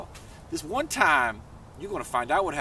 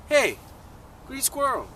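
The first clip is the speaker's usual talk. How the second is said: calling out; 10 semitones higher than usual. Acted. Toad hailing squirrel at his tree, calling out. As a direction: hail someone in a high storybook voice.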